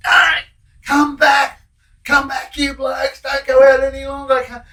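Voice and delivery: high, loud bellowing call; yelling out. Retold or imitated. Prisoner calling down to the union meeting, high loud yell. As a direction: shout it across the yard to your mates. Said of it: "he yells out".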